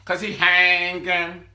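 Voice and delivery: loud, high confrontational yell; Quita confronting. Retold or imitated. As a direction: bark it, slowing on hanging. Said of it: "When she said that".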